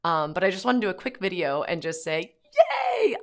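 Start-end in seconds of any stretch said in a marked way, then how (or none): 2.5-3.1 very high, loud joyful yay; excited cheer. Real, not acted. Mom celebrating with her viewers, very high shouted yay. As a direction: burst out with real joy. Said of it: "I am really excited".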